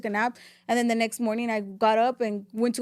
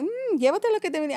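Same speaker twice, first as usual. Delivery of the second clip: higher, quick mom voice; 7 semitones higher, faster than usual. Retold or imitated. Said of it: "My mom's like".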